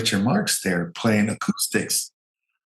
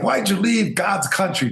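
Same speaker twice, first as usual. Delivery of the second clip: Bush teasing; 9 semitones higher than usual. Retold or imitated. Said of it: "And he's like".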